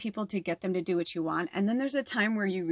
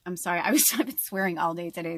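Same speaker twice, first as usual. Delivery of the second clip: normal talking voice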